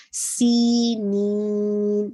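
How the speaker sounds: سینین drawn out very slowly; stretched vowel. Shown as a demonstration. Teacher modelling the stretch of سینین. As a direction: hold the سی of سینین for two counts. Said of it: "سی آپ کا ٹو count".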